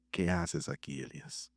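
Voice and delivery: a grave divine voice from deep; voice of God. Acted. God asks Elijah in the cave, low solemn voice.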